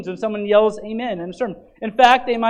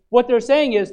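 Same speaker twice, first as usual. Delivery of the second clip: usual speaking voice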